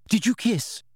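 high, brisk question. A narrator's reading. Hermione interrupting Harry's stammering, brisk. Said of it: "asked Hermione briskly".

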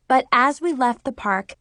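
ordinary delivery

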